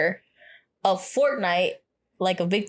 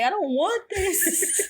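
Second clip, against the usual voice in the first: high, slow, laughing fake sob; a hammed-up teary whine, laughing; mock crying whine; 10 semitones higher, slower than usual. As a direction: whimper it high and slow through giggles. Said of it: "I was like".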